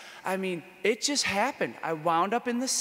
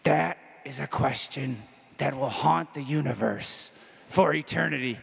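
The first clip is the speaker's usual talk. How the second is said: a hint of a grave philosopher weighing his words; 5 semitones lower than usual. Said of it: "in the philosopher way".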